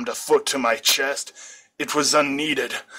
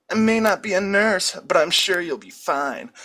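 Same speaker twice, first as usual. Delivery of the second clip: put-on light reassuring girl; 6 semitones higher than usual. A narrator's reading. Kogasa reassures him.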